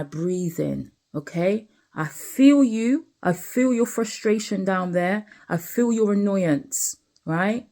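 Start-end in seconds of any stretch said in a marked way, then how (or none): 1.9-7.7 higher and louder, telling the feeling it is heard; emphatic self-talk. Acted. Sitting outside, talking to his own frustration, emphatic. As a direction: acknowledge the urge out loud, firmly.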